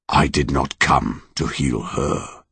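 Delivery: slow, soft monster voice; slow sighing calm. Acted. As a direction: let it out like a long sigh. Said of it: "long, slow sigh".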